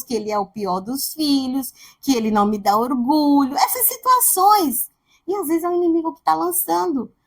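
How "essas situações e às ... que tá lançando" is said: ordinary delivery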